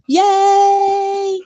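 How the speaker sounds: high, loud happy cheer. Real, not acted. Finishing the birthday song for friends with a loud cheer.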